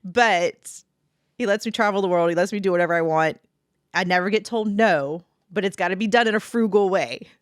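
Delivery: usual speaking voice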